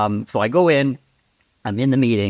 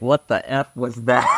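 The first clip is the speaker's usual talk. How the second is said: incredulous what the F; half-shouted disbelief; mock baffled outburst. Mock reaction to failed joke.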